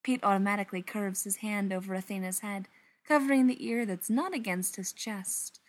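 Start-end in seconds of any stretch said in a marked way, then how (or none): none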